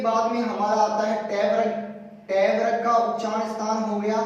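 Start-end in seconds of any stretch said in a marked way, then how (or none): none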